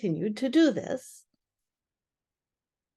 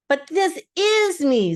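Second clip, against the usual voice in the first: higher, louder, slow surprised protest; 8 semitones higher, 5 dB louder, slower than usual. Acted. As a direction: Pooh baffled at being told he's away. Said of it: "very much surprised".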